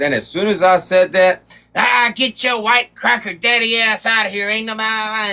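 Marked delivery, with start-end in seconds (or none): 1.8-5.3 imitating her going off, raised pitch; hammed-up retold outburst; a mocking impression of her yelling; mock angry rant. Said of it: "she just fucking went off".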